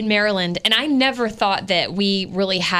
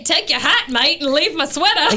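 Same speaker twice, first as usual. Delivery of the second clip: higher-pitched mock Australian accent; 7 semitones higher than usual. Joking about a singer's lyrics to co-hosts, Australian accent. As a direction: put on a playful Aussie accent. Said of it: "totally sounds Australian".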